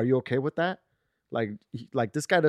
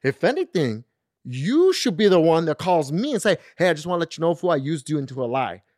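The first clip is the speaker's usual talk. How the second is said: louder, higher imitated telling-off; retold angry scolding; 4 semitones higher, 7 dB louder than usual. Friend demanding a heads up before covering, mock angry. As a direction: act out a fed-up friend, raised voice. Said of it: "fucking cussed me out and got mad".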